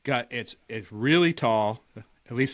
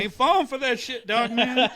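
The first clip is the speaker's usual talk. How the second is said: high mock voice; 13 semitones higher, 4 dB louder than usual. Mock reply to dog man.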